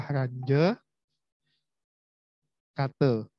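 Ranje Kate slowly, long pause between the two words; Ranje Kate pronunciation demo. Shown as a demonstration. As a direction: dictate "RAN-juh", wait, then "KAH-tuh" for Ranje Kate. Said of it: "Jadi bacanya adalah".

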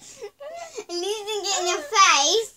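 slow, laughing through tears; forcing words out between laughs; stifled giggles. Real, not acted. Laughing hard mid-sentence.

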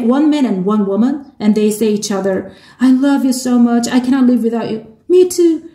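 ordinary talk

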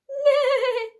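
whinny like a horse; horse neigh. Acted. Storyteller voicing the horse, loud neigh. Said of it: "says the horse".

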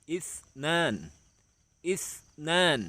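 อิสนาน split as อิส, then a long high นาน; model pronunciation. Shown as a demonstration. Teacher shows children Arabic two, อิสนาน in clear high syllables. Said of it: "ออกเสียงว่า".

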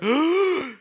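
a sharp gasp for air mid-greeting; breathless gasp. Real, not acted. Streamer greeting fans, out of breath: a gasp for air. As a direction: gulp in air, winded. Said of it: "it's because I'm out of breath".

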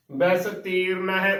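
ਬੈਸ ਤੀਰਨ with closed ਸ, long ee after ਤ; correct pronunciation. Shown as a demonstration. Teacher models the right reading, ਬੈਸ ਤੀਰਨ with ee on ਤ. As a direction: sound out ਬੈਸ ਤੀਰਨ as bais-teeran. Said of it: "ਉਚਾਰਨ ਕਰਨਾ ਹੈ".

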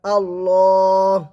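আল্লাহ with a long heavy lam and a full drawn alif; slow heavy recitation of আল্লাহ; drawn-out Allah. Shown as a demonstration. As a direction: round the lips and stretch আল্লাহ slowly. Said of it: "লাম খাড়া জবর লা".

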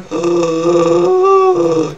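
long, loud, high inhaled moan; inward growl. Shown as a demonstration. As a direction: draw a voiced breath inward. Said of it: "you're breathing inward".

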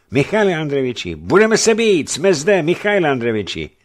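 high, loud, urgent battle calls; rallying shouts. A narrator's reading. Companions offer to fight for their leader, rallying shouts. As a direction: call out to your leader, ready to fight. Loyal men calling out to fight.

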